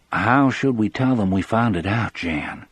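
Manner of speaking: usual speaking voice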